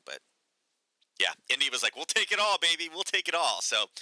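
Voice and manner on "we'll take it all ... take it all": greedy buyer. Retold or imitated. India voiced, grabbing cheap oil.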